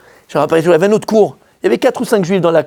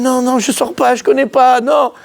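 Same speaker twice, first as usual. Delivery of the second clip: higher, hammed-up nervous no; flustered refusal; 7 semitones higher, slower than usual. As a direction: refuse in mock panic.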